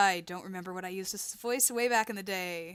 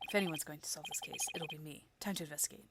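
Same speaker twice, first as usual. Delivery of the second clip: low, very quiet, disdainful voice; aloof rival; 5 semitones lower, 12 dB quieter, faster than usual. Acted. Rival student dismissing the other lawyers, low and disdainful. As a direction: look down on the amateurs under your breath.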